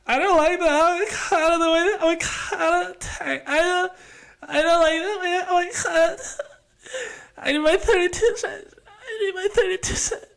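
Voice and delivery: mock whiny customer, very high and half sobbing; high, crying, laughing whine about the avocado. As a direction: wail it like a spoiled diner on the verge of tears. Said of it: "you're just like".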